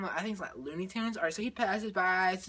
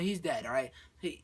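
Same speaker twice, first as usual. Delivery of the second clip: ordinary talk, nothing marked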